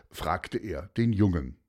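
plain reading voice

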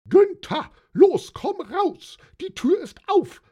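very high, loud urgent yell; bellowing. A narrator's reading. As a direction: scream for your partner to get out. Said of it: "brüllte Hildegard".

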